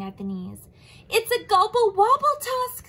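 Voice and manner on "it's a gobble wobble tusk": high, loud alarmed cry; frightened shout. Acted. Anteater crying out the monster's name to Giraffe.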